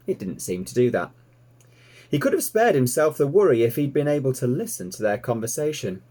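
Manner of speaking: ordinary delivery, nothing marked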